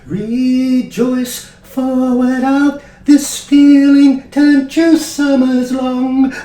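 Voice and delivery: high, loud, drawn-out mystical chant. Acted. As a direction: proclaim it like an ecstatic cosmic singer. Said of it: "oh, [name], he comes up, he goes".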